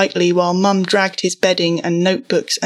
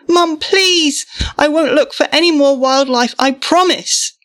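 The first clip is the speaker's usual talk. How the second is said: high, loud pleading promise; desperate begging; 9 semitones higher, 3 dB louder than usual. A narrator's reading. Boy pleading with Mum to keep the skylight, frantic. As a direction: plead as if everything hangs on it.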